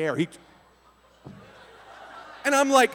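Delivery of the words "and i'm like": normal talking voice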